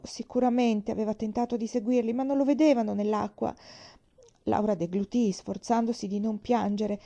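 plain reading voice

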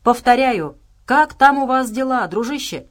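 insistent radio call; calling over the radio. A narrator's reading. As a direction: call out as if nobody is answering. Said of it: "повторял и повторял Джексон в микрофон рации".

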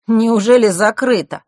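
drawn-out, theatrical dismay; feigned dismay. A narrator's reading. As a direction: act sincerely upset that it's shut. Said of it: "Казалось, Марк искренне огорчился".

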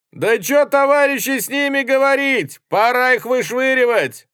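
high, very loud agitated yell; shout from crowd. A narrator's reading. Someone in the crowd yells to throw them out, shouting. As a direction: whip the others up, shouting. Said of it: "крикнул кто-то из толпы".

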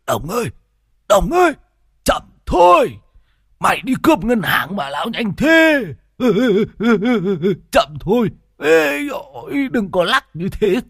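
shrill, loud carsick begging; panicked pleading. Acted. Pleading with fast driver.

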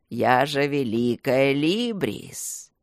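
deep, slow, grand tree voice; proud talking tree. Acted. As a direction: boast like an ancient giant. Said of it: "рассмеялось дерево".

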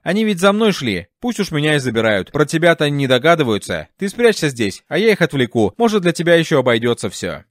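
plain reading voice

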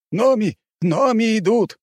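high urgent warning call; alarm shout. Acted. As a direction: cry out the alarm.

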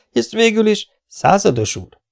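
a flustered, upset outburst; upset interruption. A narrator's reading. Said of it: "vágott közbe Stanford feldúltan". (feldúlt közbevágás)

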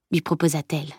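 ordinary delivery, nothing marked

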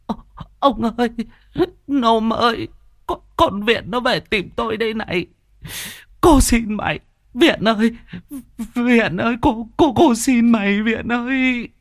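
high-pitched, stammering wail; shrieky woman tripping over her pleas; terrified pleading. A narrator's reading. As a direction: beg for mercy in a frightened cry. Terrified woman begs the ghost.

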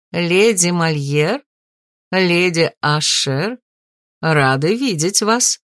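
courteous shopkeeper. A narrator's reading. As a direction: greet honoured guests with a bow in the voice. Shop owner greets ladies formally.

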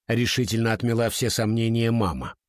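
usual speaking voice

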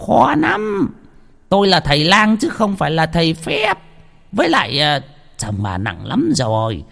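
a healer declining gently; refusing healer. A narrator's reading. Healer to the weeping wife, reluctant refusal. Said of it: "Ông lang lắc đầu".

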